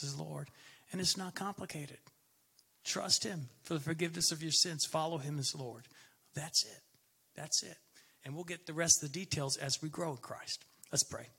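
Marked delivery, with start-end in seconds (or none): none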